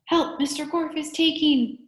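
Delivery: high, loud urgent cry; shouting for help. A narrator's reading. Allison warns the visitor about the teacher, high shouted plea. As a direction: cry out for rescue, pitched right up. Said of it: "shouted Allison".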